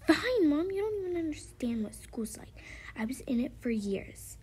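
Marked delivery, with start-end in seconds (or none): 0.1-2.5 high-pitched daughter voice, starting loud; huffy child. Pretend. Daughter gives in to mom about school, huffy. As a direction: give in like a put-out kid.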